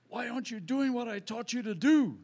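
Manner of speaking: high, loud booming divine rebuke; a loud, high, stern heavenly voice; voice of God. Retold or imitated. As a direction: call him out like God from above. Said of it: "God goes".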